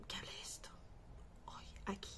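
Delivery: near-whisper, very soft; hushed. Real, not acted. Vlogger confiding who requested the video, hushed. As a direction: drop to a breath of a whisper.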